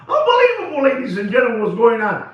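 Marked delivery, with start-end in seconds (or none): 0.1-0.8 much higher, still laughing. Real, not acted. Laughing at his own Jack story, much higher. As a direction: squeak it out through a laugh.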